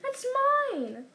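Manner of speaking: high, urgent protest; indignant protest. A narrator's reading. Boy trying to snatch letter back, indignant protest. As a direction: cry out to claim it back.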